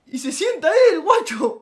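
high, loud outraged punchline; indignant. Real, not acted. Telling viewers the friend took the good seat, loud and outraged. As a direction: cry out at the betrayal.

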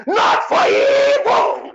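high, slow, tearful shout; crying shout. Real, not acted. Prophet crying out against evil, high tearful shout. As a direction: wail the words out. Cried-out repeat of phrase.

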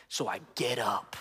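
low, hushed and slow; suspenseful storytelling. Acted. Friend building his wink story, hushed mock suspense. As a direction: draw out the mock suspense softly.

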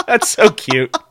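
high squeal through laughter; laughing. Real, not acted. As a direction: laugh it out, delighted. Laughing at a silly name.

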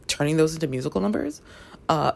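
ordinary talk, nothing marked

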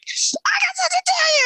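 squealed high, bursting with news; a sudden high excited shout; excited outburst. Retold or imitated. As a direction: blurt it out like you can't hold it in.

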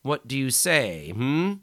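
loud, high menacing snarl. Acted. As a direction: snarl the question at the prisoner. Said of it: "he snarled".

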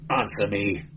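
ordinary delivery, nothing marked

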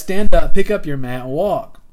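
booming, commanding order to rise; loud command. Acted. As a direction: call it out so the whole crowd hears. Jesus commands the lame man.

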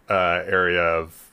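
ordinary talk

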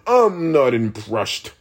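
mocking bored critic.

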